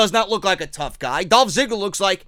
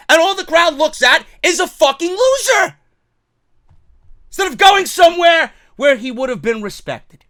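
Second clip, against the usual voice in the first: angry shout; 8 semitones higher, 9 dB louder than usual. Real, not acted. Fan ranting at WWE.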